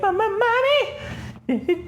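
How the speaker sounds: put-on very high, stammering small-child voice; squeaky, drawn-out imitation of a frightened child; little Bruce. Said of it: "little Bruce is like".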